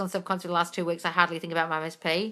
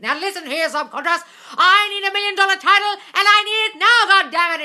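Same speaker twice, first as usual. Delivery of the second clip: much higher and louder, demanding yell; retold yelling; 12 semitones higher, 10 dB louder than usual. Napoleon Hill demands a book title from his subconscious, yelling. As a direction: bellow it at your own brain like you mean it. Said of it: "he screamed and yelled at his subconscious".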